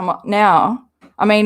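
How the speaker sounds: ordinary talk, nothing marked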